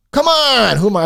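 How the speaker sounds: worked-up shout, pitch leaping up; exasperated yell. Real, not acted. Ranting, repeats the appeal much louder.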